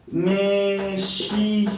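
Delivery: México stretched syllable by syllable; very drawn-out Me-shih-co; slow dictation. Real, not acted. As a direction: sound it out slowly while writing. Sounds out the word while writing.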